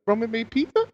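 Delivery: high incredulous repeat; lightly imitated shock; mock disbelief. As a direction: question it like it's absurd. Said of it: "He goes".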